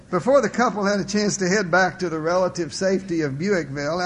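usual speaking voice

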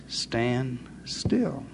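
low, soft and firm; Moses commands. Acted. Moses repeats his command to the panicking people, low and firm. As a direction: say it slow and sure. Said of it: "Say it again, Mo".

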